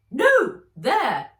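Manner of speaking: very loud high correction; sharp correction. A narrator's reading. Fred repositioning Nat's hand, in a loud high correction. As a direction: cut in sharply.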